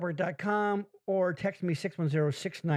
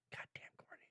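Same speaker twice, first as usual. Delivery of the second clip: hushed under the breath; whispering; 27 dB quieter than usual. Real, not acted. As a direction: whisper it aside. Hushed aside to guest.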